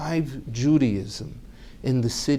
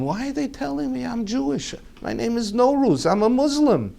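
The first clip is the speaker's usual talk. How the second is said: baffled protest; 10 semitones higher than usual. Retold or imitated. Confused protest to elders.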